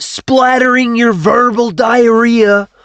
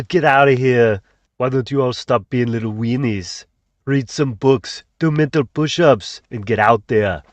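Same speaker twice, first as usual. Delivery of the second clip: put-on Austrian accent; 10 semitones lower than usual. Austrian-accented host greets the cutie pies. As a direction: lower Arnold-style Austrian accent. Arnold-style persona.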